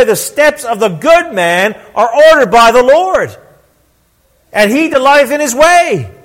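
high, driving pulpit delivery; impassioned preaching. Real, not acted. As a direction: build each phrase like a rallying preacher. Preaches with force.

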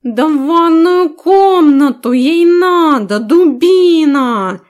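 high, slow, cheeky cat creature; impudent cat voice. Acted. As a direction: drag it out like a smug talking cat. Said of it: "наглый голос этой пушистой нечисти". (наглый кошачий голос, высоко и медленно)

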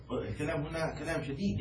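ordinary talk, nothing marked

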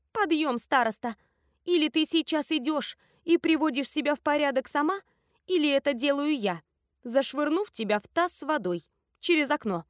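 high, threatening ultimatum; bossy wake-up order. A narrator's reading. Friend hoisting the sleeper out of bed, commanding.

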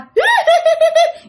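high, loud, rapid-fire cackle; ghostly cackle. Retold or imitated. Ghost laughing as dad runs away, high eerie cackle. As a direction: cackle after someone fleeing. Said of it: "nghe thằng chả cười ở làm sao".

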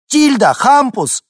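very high, loud name calls; calling the children. Acted. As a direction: call out to children far off. Мать зовёт детей. (громко зовя детей)

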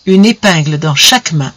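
plain reading voice

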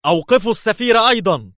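very high, loud command; shout. Acted. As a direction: bellow the order. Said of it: "فصاح برونو مرة أخرى".

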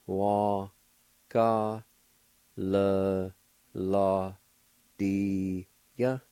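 Wa ka le la dia with each syllable set apart. Shown as a demonstration. Teacher sounding out the fifth word. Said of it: "It's pronounced like this".

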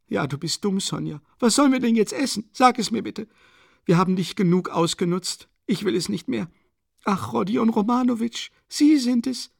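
a desperate, proud widow ranting; agitated Katharina. A narrator's reading. Narrator voices Katharina Iwanowna. (erregte, verzweifelte Mutter, höher gesprochen)

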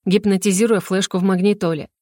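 ordinary delivery, nothing marked

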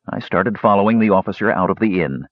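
ordinary delivery, nothing marked

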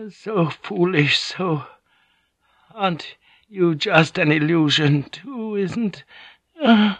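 slow, high, fading mumble; mumbling old woman. A narrator's reading. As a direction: drift off as a dying old woman. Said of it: "Yodoko began mumbling".

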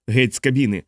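usual speaking voice

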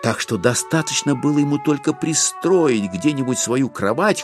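usual speaking voice

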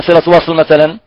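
ordinary delivery

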